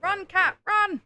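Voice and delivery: loud, high shout at the cat; high, loud playful holler; calling out. Real, not acted.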